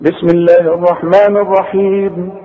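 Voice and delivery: slow measured chant with long held vowels; Quran recitation. Shown as a demonstration. Teacher replays what was read for students, Quranic chanting. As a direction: recite slowly, drawing out each verse ending. نسمع بقى اللي قريناه.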